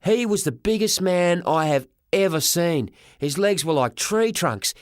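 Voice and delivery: ordinary delivery, nothing marked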